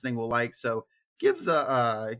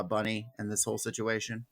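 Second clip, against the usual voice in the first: ordinary talk, nothing marked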